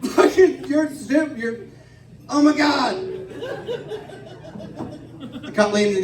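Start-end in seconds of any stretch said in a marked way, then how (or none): 0.0-3.0 high-pitched, cackling jeer; hammed-up mocking laughter. Drunk passenger jeers at his friend, laughing mockery. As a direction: crow it through drunken laughter, high and drawn out.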